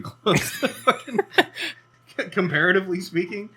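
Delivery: laughing through the words. Real, not acted. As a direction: try to talk while cracking up. Cracking up at the map.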